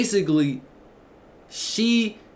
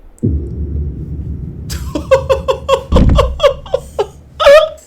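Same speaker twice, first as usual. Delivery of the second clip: loud cackle climbing very high; long high laugh; 7 dB louder than usual. Real, not acted. Streamer cracking up at the insulting text, long high laugh.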